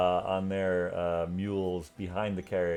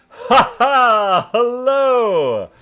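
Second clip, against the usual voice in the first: loud, high, laughing hello from afar; booming hearty greeting; 15 dB louder, much slower than usual. Acted. Noble rides up calling out a hearty greeting. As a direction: bellow a jolly greeting across the field.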